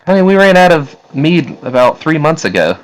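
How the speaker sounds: high-pitched woman explaining; woman's voice. Acted. Woman replying to an accusation of drinking, high woman's voice. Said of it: "a voice from a woman say".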